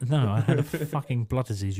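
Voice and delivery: normal talking voice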